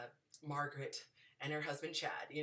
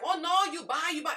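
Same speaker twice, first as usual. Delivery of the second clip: much higher, loud mock Italian accent; bad Roman accent; 12 semitones higher, 10 dB louder than usual. Vendors push back on the tourist, high loud mock Italian. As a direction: squeal it like an insistent Roman vendor. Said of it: "that's not a Roman accent at all".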